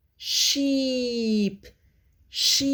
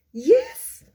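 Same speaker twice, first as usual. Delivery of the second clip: excited high yes; 14 semitones higher, 8 dB louder than usual. Acted. Cheering a child's answer.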